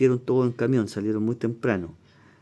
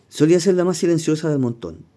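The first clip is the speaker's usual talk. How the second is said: reads the quote higher and louder; 6 semitones higher, 9 dB louder than usual. Retold or imitated. Teacher reading a singer's words to the class, louder and higher. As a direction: lift the quote above the title read.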